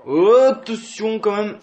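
high, loud, slow warning cry; drawn-out high alarm; alarmed shout. Real, not acted. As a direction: yell out a warning. Tense moment in match.

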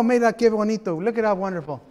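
normal talking voice

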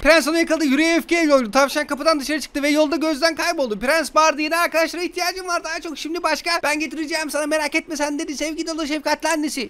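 lightly voiced storybook narration; reading to a child. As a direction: read the bedtime tale aloud.

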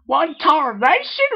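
very high, loud, slow country cry; shocked exclamation. Acted. Applejack reacts to Pinkie's whisper, hollering very high. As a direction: holler in disbelief. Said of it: "replied Applejack".

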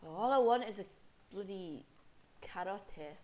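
ordinary talk

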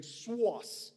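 Boston accent on Source, dropping the r like swoss. Real, not acted. Preacher laughs at his own Boston accent. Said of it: "I have this Boston accent just saying".

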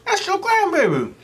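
high, rising shock, slowing at the end; retold shocked outburst; shocked disbelief. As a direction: gasp it out like you can't believe it. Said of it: "I said".

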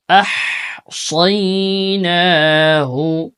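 أحصيناه drawn out slowly with the ha said clearly; clear ha sound. Shown as a demonstration. Teacher demonstrating أحصيناه slowly for students. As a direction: sound every letter of أحصيناه slowly, ha included. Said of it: "হার উচ্চারণটা খেয়াল করব, একেবারে পরিষ্কার করে".

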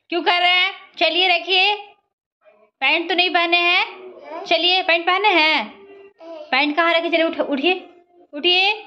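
put-on high sing-song coaxing to a toddler; mother urging toddler up, high baby talk. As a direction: lift the pitch and coax her up.

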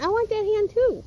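playful high pet voice; voicing the dog. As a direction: speak up high for the dog's thoughts. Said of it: "He said".

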